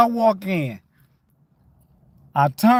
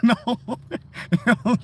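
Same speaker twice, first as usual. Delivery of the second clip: ordinary talk, nothing marked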